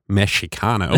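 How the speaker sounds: "Mexicano" with a Spanish "x" sound; Mexican Spanish pronunciation. Shown as a demonstration. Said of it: "how the Native Americans pronounce it".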